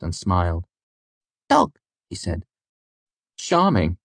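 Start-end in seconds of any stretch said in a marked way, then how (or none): 1.5-1.8 put-on high-pitched toddler voice; little boy. A narrator's reading. Toddler's first word voiced in story. 3.4-4.0 dry remark, pitched up. A narrator's reading. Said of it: "his voice dry".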